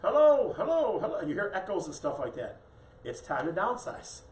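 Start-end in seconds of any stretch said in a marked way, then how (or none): 0.0-1.2 loud, high-pitched hello called out; yelling across. Acted. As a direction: holler it across a big empty house. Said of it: "you're yelling across".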